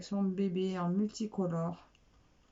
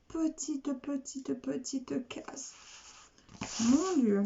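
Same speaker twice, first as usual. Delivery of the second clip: marvelling at tiny things; 5 semitones higher, slower than usual. Real, not acted. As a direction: coo over the tiny little boxes. Marvelling at the tiny paint areas.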